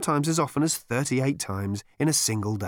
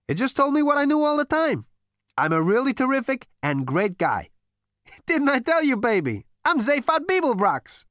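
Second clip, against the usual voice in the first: high, loud smug boast; smug Zaphod; 14 semitones higher, 5 dB louder than usual. Acted. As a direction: brag like the greatest guy. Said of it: "Zaphod shrugged smugly".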